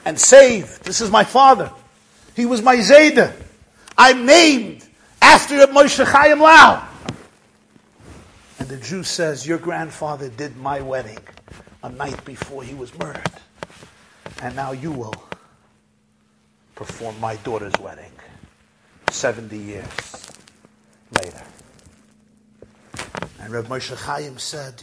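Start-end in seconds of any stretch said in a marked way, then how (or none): 0.1-6.9 loud declarations building to a cry; impassioned revelation. Retold or imitated. Rabbi revealing his grandfather to survivor, loud and impassioned. 9.5-21.4 low, soft, with long pauses; solemn hush. Retold or imitated. Old man telling rabbi of second wedding, hushed solemnity.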